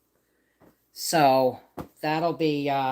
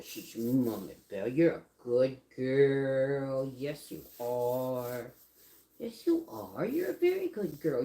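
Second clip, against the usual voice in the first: cooing to dog; 3 semitones lower, 5 dB quieter than usual. Real, not acted. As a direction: praise the dog tenderly.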